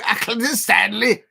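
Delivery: loud, exaggerated Scouse catchphrase; attempted Scouse accent. Shown as a demonstration. Fan shows the only Scouse he can do, exaggerated accent. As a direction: put on a hammy Scouser accent. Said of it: "is that a Scouser's accent?".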